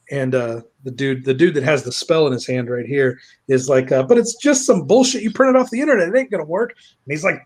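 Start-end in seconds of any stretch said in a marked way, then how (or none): 4.0-6.7 high-pitched objection; mock whiny protest. Pay pig doubting the spell, mock protesting. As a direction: complain that the plan is useless.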